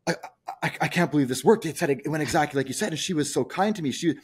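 breathless amazement at his own success; amazed client. Retold or imitated. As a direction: gush in disbelief like a thrilled client. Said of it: "it's remarkable how they react".

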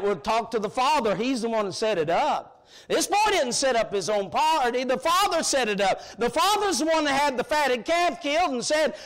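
normal talking voice

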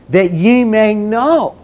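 preacher driving home the word know; stress on know. Shown as a demonstration. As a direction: lift and slow down into know. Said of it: "So emphasize the word know".

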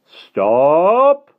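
high, loud villain's shout across water; ringing shout. Acted. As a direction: bellow after the fleeing children. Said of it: "a deep voice rang across the water".

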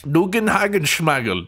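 nonsense words in a mock German accent; fake German. Gamer urging troops onwards, fake German. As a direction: put on gibberish German. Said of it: "That's fake German for onwards".

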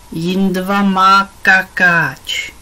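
pomalu a šišlavě jako malé batole, hlasitěji; protáhni to jako dvouleté dítě, co se dožaduje pozornosti; dětské křičení. A narrator's reading. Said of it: "křičel teď Jindra". (batole Jindra křičí na Pipu, že má plnou plínku)

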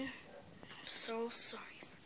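hushed apology; whispering. Real, not acted. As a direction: whisper it sorry. Apology under breath.

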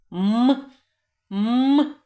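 sustained isolated "М"; hard consonant. Shown as a demonstration. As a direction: hum "М" by itself to show a hard consonant. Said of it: "А твердий чи м'який?". (протяжний твердий звук "М")

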